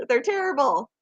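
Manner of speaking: ordinary delivery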